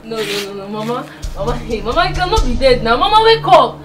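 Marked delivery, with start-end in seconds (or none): 3.0-3.8 high, loud sobbing wail, begging her to wake; wailing grief. Acted. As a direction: cry out to her through sobs, voice cracking. Wailing over dead grandmother.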